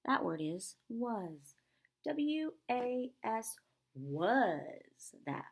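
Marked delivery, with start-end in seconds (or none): 2.1-5.1 slow letters sliding downward. Shown as a demonstration. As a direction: glide down like a slide. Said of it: "going down a slide".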